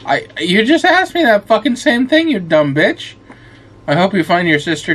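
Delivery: high, sharp snap at the NPC; annoyed outburst. Real, not acted. Player snapping at a repetitive NPC, annoyed and high. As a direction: tell the dumb character off.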